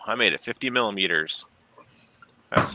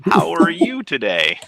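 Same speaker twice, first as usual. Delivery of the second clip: high goofy voice; 8 semitones higher, 4 dB louder, slower than usual. Pretend. Mock friendly greeting to himself.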